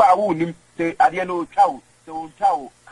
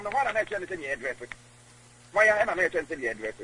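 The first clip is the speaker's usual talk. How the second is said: ordinary talk, nothing marked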